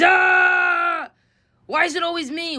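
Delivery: put-on high, whiny kid shout; mock teen scream. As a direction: screech it like a fed-up teenager. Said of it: "he screams".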